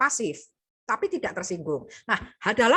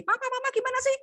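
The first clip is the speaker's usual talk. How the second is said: high, shrill-pitched accusing rant; much faster than usual. Shown as a demonstration. Teacher acts a child accusing late parents, voice high. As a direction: blame them, voice way up. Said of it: "Nah, itu menuduh".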